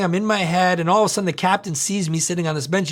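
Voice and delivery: normal talking voice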